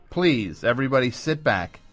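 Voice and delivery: usual speaking voice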